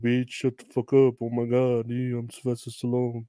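mock slurred tough-guy movie-star voice; Sylvester Stallone. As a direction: imitate him running the words lazily together. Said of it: "That's my Sylvester Stallone voice".

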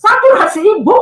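high, excited nurse's report; astonished announcement. Retold or imitated. Nurse telling the patient her saturation, astonished announcement. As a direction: imitate an amazed nurse. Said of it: "Dia kaget-kaget luar biasa".

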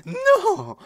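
loud high wail of dismay; anguished cry. Real, not acted. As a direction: cry out in despair. About to kill, gets killed.

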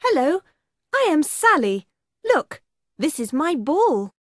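usual speaking voice

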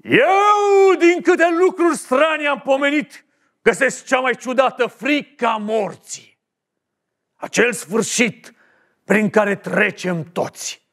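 high, grand theatrical declaiming; dramatic. Acted. Actor playing Caesar declaims grandly for director. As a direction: declaim it like a tragic hero.

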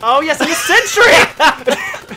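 high, loud and laughing; thrilled laughing yells; excited. Real, not acted. Explosion in game, laughing.

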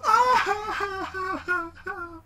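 high wailing cry imitating a teen; hammed-up teenage howl; mock wailing sob. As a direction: wail it out like a distraught daughter. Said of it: "she started crying".

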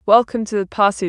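ordinary delivery

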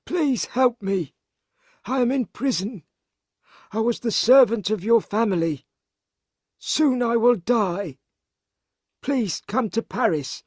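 high-pitched, beseeching character voice; desperate letter read in character; pleading imprisoned servant. Acted. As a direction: beg for rescue in a high voice.

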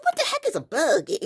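very high, fast, loud incredulous question; high-pitched teasing bafflement; mock disbelief. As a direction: squawk it in amused disbelief. Retelling her amused disbelief, laughing.

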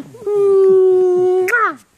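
big smacking kiss; loud kiss smack. Real, not acted. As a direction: blow a loud noisy kiss. Mother kissing her baby.

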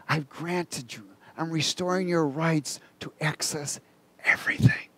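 soft, intimate message from God; quietly voiced revelation, fading to whisper; hushed divine voice. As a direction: drop to a hush, ending in a whisper. Voicing God speaking softly to him.

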